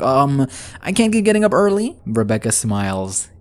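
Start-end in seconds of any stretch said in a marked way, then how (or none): 0.0-2.0 covering lie. Retold or imitated. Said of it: "She's like".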